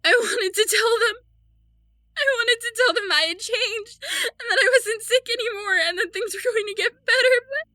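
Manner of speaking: high, sobbing confession; tearful, breaking voice up high; crying. Acted. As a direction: cry through the words. Confessing killing family, crying.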